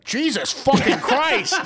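loud, drawn-out exclamation; slow, laughing shout; Peter's exclamation. Retold or imitated. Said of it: "Peter comes and he's like".

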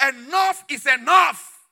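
loud, slow shout, drawing out each word; fed-up outburst. Retold or imitated. Preacher re-enacting his cry against challenges, fed-up shout. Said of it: "I got tired of the challenges".

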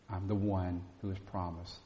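usual speaking voice

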